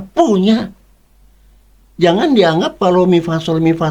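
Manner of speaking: pitched high, pressing each question at the church; high rhetorical preaching. Acted. Preacher challenging churchgoers, insistent high questions. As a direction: hammer the questions home from up high.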